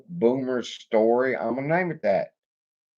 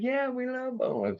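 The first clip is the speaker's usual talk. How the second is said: put-on girlfriend's voice; 6 dB quieter than usual. Quoting girlfriend.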